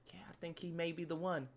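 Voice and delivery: soft, dreamy admission; smitten girlfriend. Acted. Girlfriend talking about this dude, smitten. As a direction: confide softly about your new man. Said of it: "She's talking crazy about this dude".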